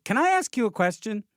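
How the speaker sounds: high, loud, pointed tone; ominous question. Retold or imitated. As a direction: ask it like trouble is coming. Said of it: "when someone says".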